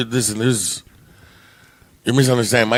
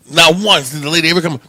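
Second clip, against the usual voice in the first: ordinary talk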